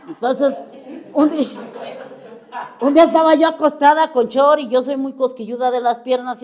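laughing. Real, not acted. Laughs telling tickle story.

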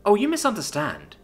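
high, poised correction; determined. A narrator's reading. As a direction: correct a rival with assurance. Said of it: "with a determined smile".

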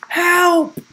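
high, loud shout; shouted cry for help. A narrator's reading. Trapped boy calling for rescue, shouting. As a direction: call out urgently for rescue. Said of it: "Will shouted".

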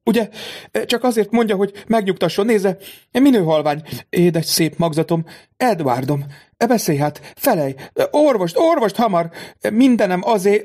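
high, frantic pleading for her son; desperate lament. A narrator's reading. Mother pleads with the count to save her son. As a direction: beg for a doctor in panic. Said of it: "megcsillapítani a siránkozót".

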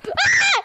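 shrill yelp of panic; high panicked scream. Real, not acted. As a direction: scream as you're jumped. Ambushed in game.

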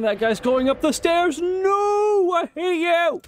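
very high-pitched panicked game yell; real high shriek of frustration; frantic screaming. Real, not acted. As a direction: scream in escalating rage at the game. Yelling at game character escaping.

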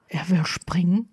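whispered, voiceless question; stunned, toneless whisper. A narrator's reading. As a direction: ask it with the voice drained out. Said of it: "fragte Harry tonlos".

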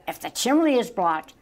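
high, worried cry; mild wail of alarm; cried out. A narrator's reading. Cub fears Santa is blocked, crying out. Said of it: "Mungo cried".

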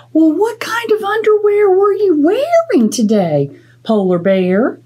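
a helpful tiny mouse asking; high curious mouse. Acted. Mouse's voice in the story.

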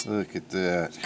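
ordinary delivery

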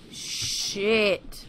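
ordinary delivery

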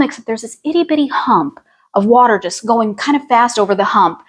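ordinary talk

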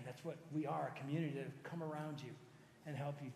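usual speaking voice

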